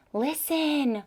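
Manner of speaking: ordinary delivery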